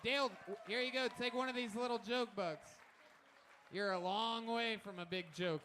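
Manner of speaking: high, loud showman send-off; announcer hype. Acted. Host sending the comic off to cheers, loud announcer. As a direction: boom it out to the crowd.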